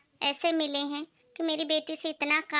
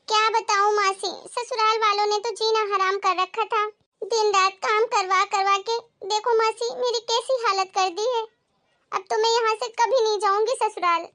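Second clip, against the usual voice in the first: higher-pitched, put-upon daughter; plaintive, high-pitched griping; aggrieved complaint; 4 semitones higher than usual. Acted. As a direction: pour out your grievances to your aunt. Daughter complains about in-laws to aunt.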